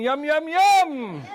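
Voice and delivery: high, loud, slow sing-song yums; loud high drawn-out yum yum yum; gleeful yum. Retold or imitated. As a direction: savour it with glee. Eating happily.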